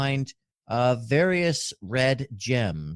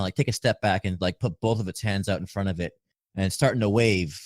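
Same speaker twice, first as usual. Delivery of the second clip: usual speaking voice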